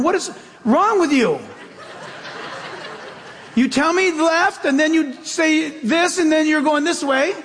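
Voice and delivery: mock outrage at a talking GPS voice; exasperated at the GPS. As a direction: scold the GPS with a laugh. Arguing with the GPS.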